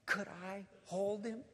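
slow, tentative plea of a moved man; imitated tearful request. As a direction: ask gingerly, as if overcome. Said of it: "And in tears".

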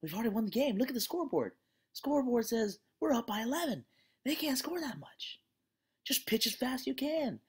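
high, lively pep talk from dad on the mound; encouraging coach dad. Retold or imitated. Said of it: "and he says".

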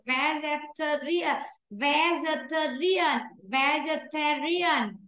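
Vegetarian drawn out slowly, stress on ta; slow model word. Shown as a demonstration. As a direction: sound out Vegetarian syllable by syllable. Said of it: "trọng âm nó rơi đỏ rồi".

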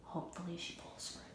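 hushed prank whisper. Real, not acted. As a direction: whisper so she won't hear. Sneaky intro before the prank.

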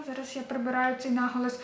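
ordinary delivery

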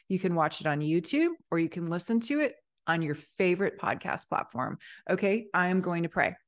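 ordinary talk, nothing marked

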